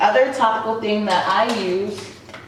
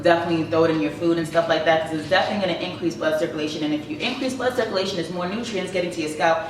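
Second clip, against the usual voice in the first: rapid, rushed run-on explanation; talking too fast; faster than usual. Real, not acted. Vlogger explaining cayenne to viewers, talking way too fast. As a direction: race through it without stopping for breath. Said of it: "I'm talking way too fast".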